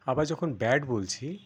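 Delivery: usual speaking voice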